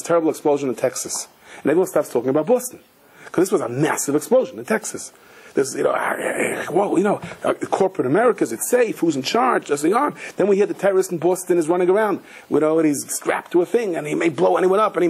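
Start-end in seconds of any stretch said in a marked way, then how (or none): none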